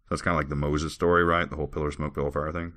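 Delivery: ordinary delivery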